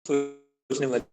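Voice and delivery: choppy, cutting-out audio; radio breaking up. Real, not acted. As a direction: voice dropping out on a bad line. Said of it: "sir, आवाज cut रही है काफी".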